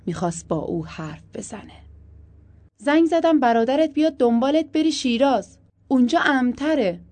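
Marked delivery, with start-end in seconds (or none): 2.8-7.0 loud, high phone-call urging; pressing and loud; urgent instruction. A narrator's reading. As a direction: raise the voice to persuade her.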